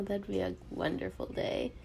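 ordinary talk

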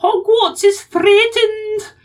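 yelled, very high, slow Scottish rallying cry; Minerva McGonagall. Acted. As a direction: shriek it like a hammed-up Scottish headmistress. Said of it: "people get me to do the McGonagall one".

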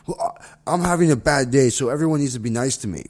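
acting out someone demanding special treatment; mock self-centred complaint. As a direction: put on an entitled complaint. Mimics someone demanding sympathy.

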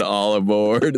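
ordinary talk, nothing marked

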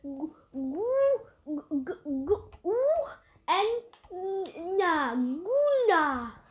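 very slow, drawn out; slow spelling out. Shown as a demonstration. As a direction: sound it out slowly. Sounding out name slowly.

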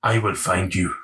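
low movie-hero threat; lightly mocking low menace; film tough-guy impression. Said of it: "You fucking ruined it then".

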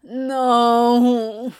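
loud drawn-out wail; yowling. A narrator's reading. Trapped Anthony protesting to the others, yowling. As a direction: yowl it out in protest. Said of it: "Anthony yowled".

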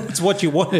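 high laughing retort. Real, not acted. Brewer laughing back at critics, high and giggly. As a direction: laugh it out, voice jumping up.

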